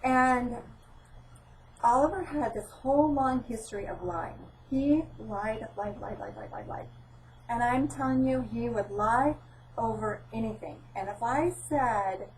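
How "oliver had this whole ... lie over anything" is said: normal talking voice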